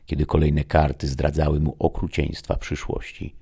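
ordinary delivery, nothing marked

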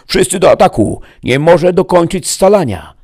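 loud, high battle command; shouted order. A narrator's reading. Chief ordering all to attack, shouted command. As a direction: shout the order to every troop. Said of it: "Wydał rozkaz".

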